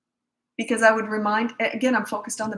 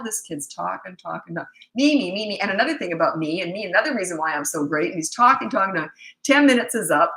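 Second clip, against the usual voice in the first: normal talking voice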